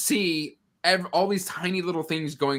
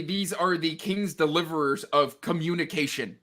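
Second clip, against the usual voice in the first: ordinary talk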